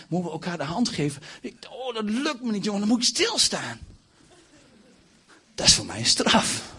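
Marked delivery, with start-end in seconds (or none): 1.4-3.8 high, strained protest; acted agitation. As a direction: plead it high, as if unable to hold still. Acts out inability to stand still. (gespeeld onrustig, hoge stem)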